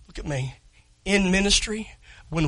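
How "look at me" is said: quiet. Real, not acted. Preacher quietly asking the congregation to look at him. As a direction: Look at me dropped soft and low, me falling. First soft take.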